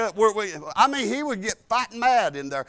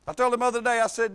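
usual speaking voice

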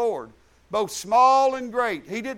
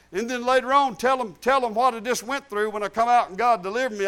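normal talking voice

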